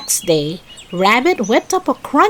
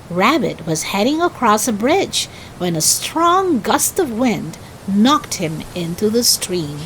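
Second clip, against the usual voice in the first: usual speaking voice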